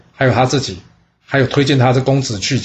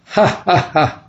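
mocking laugh; 5 semitones higher, much slower than usual. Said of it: "他挖苦公子宋说".

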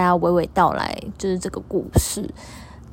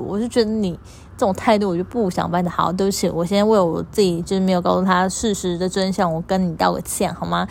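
usual speaking voice

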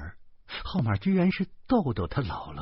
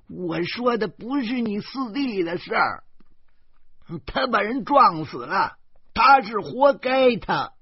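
put-on high, loud old woman's outburst; grandma hollering that he deserved it; old woman yelling; 12 semitones higher, 5 dB louder than usual. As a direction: shout it down the phone, stressing 不. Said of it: "老太太嚷嚷起来了".

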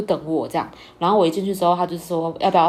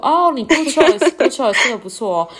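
slow, higher and louder, hammed-up praise; imitating husband; 5 semitones higher, 5 dB louder than usual. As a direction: drawl it like a proud wine expert. Husband praising her wine pick. (夸张模仿老公慢慢称赞)

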